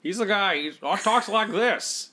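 high, drawn-out movie-star imitation; hammed-up old Hollywood voice; bad Jimmy Stewart. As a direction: do a terrible, slow, high-pitched celebrity impression. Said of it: "No, it's a terrible impression".

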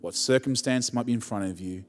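usual speaking voice

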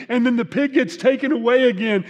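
ordinary talk, nothing marked

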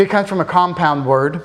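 usual speaking voice

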